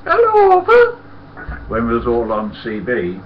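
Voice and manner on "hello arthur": very high, loud squeal of a greeting; shrieky high-pitched mimicked greeting; Simple Huey. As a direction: squeal hello in a silly high voice. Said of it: "Simple Huey".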